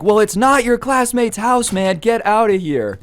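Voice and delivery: high-pitched mock-furious order; imitated indignant yelling, pitch way up; angry dismissal. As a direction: act out the homeowner throwing someone out. Acted homeowner ordering intruder out.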